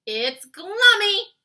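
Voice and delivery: very loud, high, slow ecstatic cry; delighted cry. A narrator's reading. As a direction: cry out with pleasure. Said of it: "he cried".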